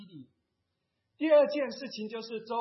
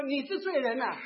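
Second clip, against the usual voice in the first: higher, louder acted reproach; mock indignant accusation; accusing; 6 semitones higher, 5 dB louder than usual. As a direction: throw the blame back, voice raised. Acts out accusing someone back.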